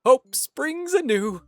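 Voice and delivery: lightly voiced elder, musing then hopeful; storybook elder with lilting delivery; wistful game elder. Lonely character greets two newcomers, lightly voiced and hopeful.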